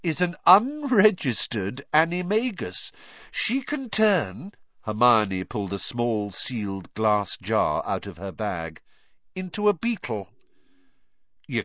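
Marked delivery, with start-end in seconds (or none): 0.0-4.5 high, building up the reveal; quiet triumph. A narrator's reading. Hermione telling Rita's secret, with quiet triumph. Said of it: "voice trembled with quiet triumph". 9.3-10.3 high reveal with restrained triumph; quiet triumph. A narrator's reading. Hermione completing the reveal to the boys, triumphant. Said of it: "voice trembled with quiet triumph".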